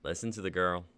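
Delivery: low soft warning. Acted. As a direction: lean in, low and quiet.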